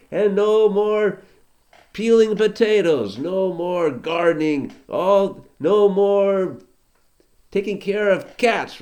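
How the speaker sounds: royal decree. Acted. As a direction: announce each ban like a decree.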